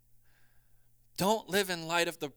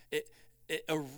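ordinary talk, nothing marked

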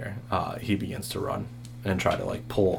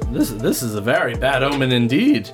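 higher, louder ominous voice; ominous fortune teller; 5 semitones higher, 8 dB louder than usual. Acted. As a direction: warn of doom. Fortune teller figure speaks.

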